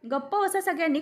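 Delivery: angry order. Acted. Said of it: "आजी चिडते आणि म्हणते".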